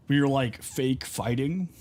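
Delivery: ordinary delivery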